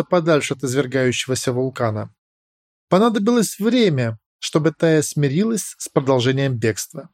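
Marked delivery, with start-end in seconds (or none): none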